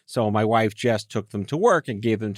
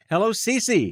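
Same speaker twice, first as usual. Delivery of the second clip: cheerful greeting; 10 semitones higher, slower than usual. Real, not acted. Greeting child guest.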